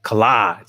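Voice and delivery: COLLAGE as kuh-LAHZH, stress on the second syllable; self-corrected pronunciation. Real, not acted. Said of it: "get my little tongue twisted".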